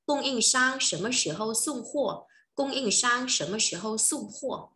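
Chinese question said slowly for repeating; teacher drills the question slowly; slow model question. Shown as a demonstration. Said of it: "mình có thể hỏi là".

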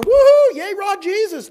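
loud, high stadium whoop; mock fan cheering. Preacher mocking a cheering fan, loud and high. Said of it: "be a fan going".

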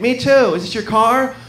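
high, light voice of a date; acted date.

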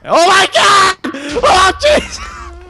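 very high shocked shriek; startled scream. Real, not acted. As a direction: shriek in sudden surprise. Startled by game event.

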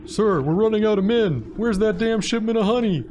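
high, loud frantic report; panicked soldier. Acted. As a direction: cry out in alarm.

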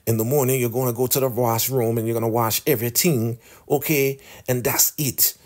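high, put-on bossy old woman; stern elder orders. Elder ordering Lacey to wash, high stern put-on voice. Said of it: "She's like".

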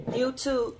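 word broken by a hiccup. Real, not acted. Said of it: "I got the hiccups".

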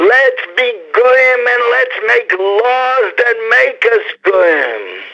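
high, scornful put-on voice of the court; hammed-up mockery pitched high; mocking judges' voice. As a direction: voice the judges as sneering rebels. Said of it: "that's what they want to do".

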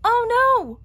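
high, loud cry of alarm; screaming dismay. Acted. Sisters discover missing slice, shrieking alarm. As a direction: shriek it like the pizza is ruined. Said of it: "were screaming".

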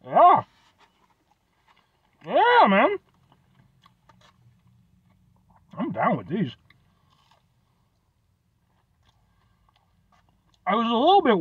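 eating between phrases; talking while chewing. Real, not acted. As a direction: mouth partly full. Seen 1: Holds pastry, bites at 4.6s, chews between words, speaks after.

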